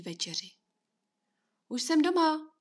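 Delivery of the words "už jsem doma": put-on boy calls out high and loud on arriving home; a raised, happy shout into the house; calling out. A narrator's reading. Boy arriving home calls to his mother, raised voice.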